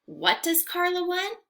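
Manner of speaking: normal talking voice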